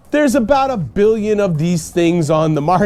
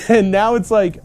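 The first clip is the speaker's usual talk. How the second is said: ordinary talk, nothing marked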